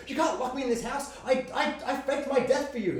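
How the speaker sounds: high, pleading mock shout as the mother; angry mum. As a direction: cry out as the dead mum who faked her death. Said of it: "his mum's like".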